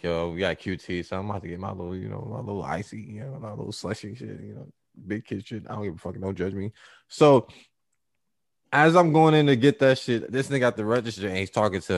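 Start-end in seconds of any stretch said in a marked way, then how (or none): 0.0-6.7 sheepish murmur. Retold or imitated. Sheepish aside about slushy.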